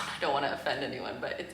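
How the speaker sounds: usual speaking voice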